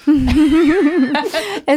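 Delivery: laughing through the question; giggling, slowed by laughter. Real, not acted. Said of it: "C'est vrai".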